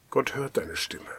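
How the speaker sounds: hushed, breathy whispered promise; soft whisper. Acted. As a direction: whisper it like a secret comfort. Preacher repeats promise softly.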